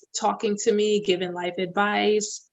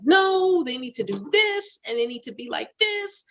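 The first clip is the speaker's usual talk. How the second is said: insistent mother; 8 semitones higher than usual. Retold or imitated. Voicing her insistent self.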